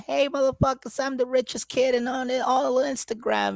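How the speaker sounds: put-on high, bratty kid bragging voice. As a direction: brag like a spoiled child influencer. Impersonating [name] bragging.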